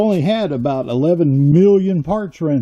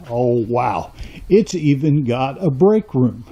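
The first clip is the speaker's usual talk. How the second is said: ordinary talk, nothing marked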